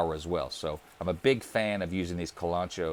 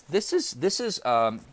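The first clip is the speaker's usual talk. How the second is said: normal talking voice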